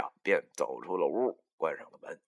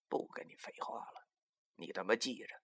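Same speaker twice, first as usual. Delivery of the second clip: dismissive and cocky, under the voice; insolent rascal; 14 dB quieter than usual. A narrator's reading. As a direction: play the shameless rogue, keeping it quiet. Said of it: "摆出了他那副无赖样儿".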